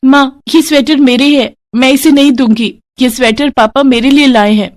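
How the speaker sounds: put-on a small girl refusing to give up her gift; defiant little girl. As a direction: daughter refusing her stepmother, childlike. Daughter defends her sweater.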